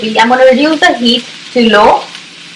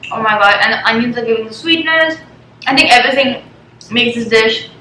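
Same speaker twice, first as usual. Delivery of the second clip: ordinary talk, nothing marked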